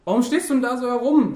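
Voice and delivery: loud, high, irritated yell; annoyed shout. A narrator's reading. Labude yells at his friend across the street, annoyed shout. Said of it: "schrie Labude".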